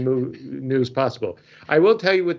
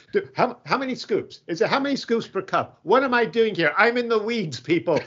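lightly acted panic, higher voice; flustered coffee novice; 7 semitones higher than usual. Stand-in coffee maker to co-workers, coffee guy is out, mock flustered. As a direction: play a mildly overwhelmed stand-in coffee maker.

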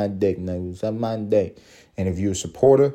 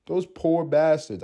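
slow, a little higher sigh of pity; 6 semitones higher, slower than usual. Acted. Host repeats his lament for aliens, calmer and slow. Pities captured aliens again.

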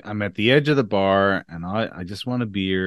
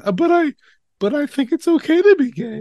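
very high, louder, timid objection; high meek voice; 4 dB louder than usual. Retold or imitated. Mocked customer thinking back at the bartender, high meek voice. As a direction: imitate your own meek protest, voice way up. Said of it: "And I'm thinking".

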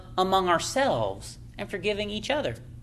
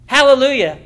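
a louder, fervent Hallelujah; 4 semitones higher, 10 dB louder than usual. Real, not acted. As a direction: shout it out to the congregation. Preacher exclaiming praise, louder.

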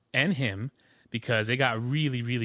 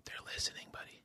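hushed, barely voiced aside; mock whisper; 18 dB quieter, faster than usual. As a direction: whisper it confidingly to a little one. Hushed warning to a baby.

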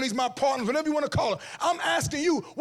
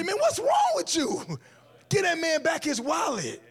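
much higher, slow outraged scold; an honest friend shocked and telling you off; 5 semitones higher, slower than usual. Pretend.

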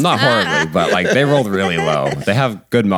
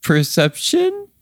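high sing-song; slower than usual. Acted. DM asks for roll.

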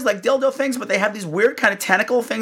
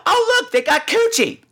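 high, delighted oh look; excited church lady. Retold or imitated. Church lady spots the product, squealing with delight. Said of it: "she just goes".

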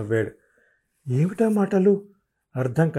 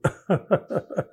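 higher leering cackle; short evil snicker; wicked laugh; 7 semitones higher than usual. A narrator's reading. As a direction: laugh at her nastily. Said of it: "అన్నాడు వికటంగా నవ్వి".

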